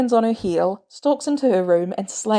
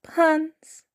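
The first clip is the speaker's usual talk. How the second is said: small scared voice; 9 semitones higher than usual. Acted. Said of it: "Peter's voice gets really small".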